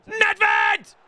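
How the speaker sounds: high-pitched yell of the player's name; excited shout. Real, not acted. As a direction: holler it like a goal celebration.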